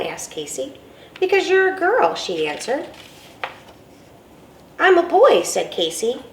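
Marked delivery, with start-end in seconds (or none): 1.2-2.1 put-on know-it-all girl. Girl corrects Casey. 4.8-5.4 put-on insistent little boy.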